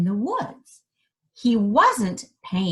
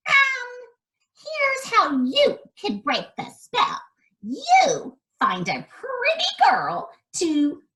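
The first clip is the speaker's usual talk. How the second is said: high, gleeful taunting witch; 13 semitones higher than usual. Acted. Witch taunting the frog prince about breaking the spell, high and gleeful. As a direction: tease him like a smug witch.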